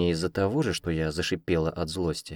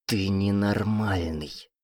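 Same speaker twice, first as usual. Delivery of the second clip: angry hiss; much slower than usual. A narrator's reading. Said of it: "зло прошипела я".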